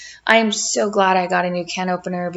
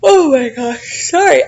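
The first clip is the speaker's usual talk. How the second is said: yawning; 5 semitones higher, 5 dB louder than usual. Real, not acted. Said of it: "I am yawning".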